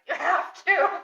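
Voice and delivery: high-pitched imitated demand; hammed-up, high insistence; mock insistence. Acted insistence in retold exchange.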